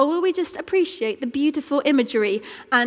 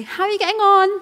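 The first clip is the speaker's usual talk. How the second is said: higher sing-song calling out; mum calling; 9 semitones higher, 4 dB louder, faster than usual. Retold or imitated. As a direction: call up to the child's room. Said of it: "I call her and I say".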